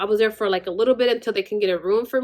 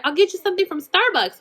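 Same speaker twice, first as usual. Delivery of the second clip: higher, breezy promising mom voice; imitating mom; 9 semitones higher, faster than usual. Mom promising her hungry daughter Starbucks, lightly higher mock voice. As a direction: toss off the promise like an easy-going mother. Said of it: "She's, like".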